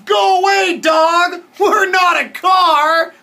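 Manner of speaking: high, loud storybook yell; shooing shout. A narrator's reading. Danny to the dog chasing them, shooing shout. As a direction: yell to chase it off, stretching the end.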